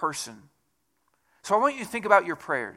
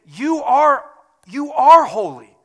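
much higher and louder, slow declaration; fervent proclamation; 9 semitones higher, 9 dB louder, slower than usual. Acted. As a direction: proclaim it with rising awe. Proclaiming God is holy.

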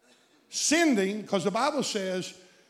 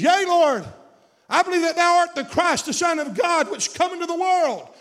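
high, loud proclaiming of faith; Martha's cry of faith; 10 semitones higher, 7 dB louder than usual. Retold or imitated. Preacher voicing Martha proclaiming her faith to Jesus, loud and high. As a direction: ring it out high and strong.

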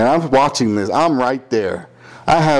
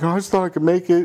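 meek excuse; 6 semitones higher, faster than usual. Retold or imitated. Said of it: "G-Man's like".

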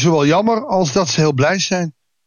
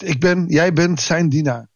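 ordinary talk, nothing marked

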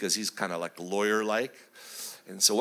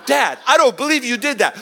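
loud, high kid imitation; outraged child; 11 semitones higher, 10 dB louder than usual. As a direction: protest in a loud, high boy's voice. Son outraged after soap prank.

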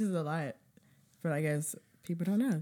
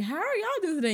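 bright high waitress greeting; high sing-song server voice; chirpy waitress; 11 semitones higher, faster than usual. Retold or imitated. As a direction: mimic a perky server asking.

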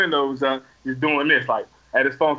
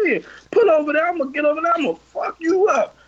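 high-pitched retold challenge; heated threat; 9 semitones higher, faster than usual. Him daring the driver to pull over, high heated threat. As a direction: reenact the threat with pitch well up.

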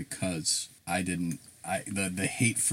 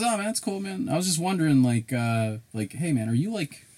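much higher, casual mock clerk; 6 semitones higher than usual. Retelling the clerk asking his ethnicity, higher. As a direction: ask it like a chummy bro.

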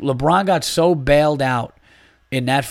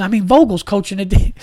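much higher and louder exasperated outburst; imitating a ranting friend; 8 semitones higher, 7 dB louder than usual. Friend scoffs at the coach, high loud mock disbelief. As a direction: pitch it up in disbelief like your worked-up buddy. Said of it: "he talks really fast".

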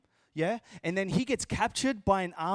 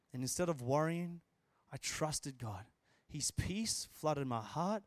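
softer, lower, sincere voice; tender testimony; 6 semitones lower, 7 dB quieter than usual. Real, not acted. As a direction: share a painful loss quietly and gently. Recounts wife's father's death to congregation.

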